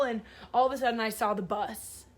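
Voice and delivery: normal talking voice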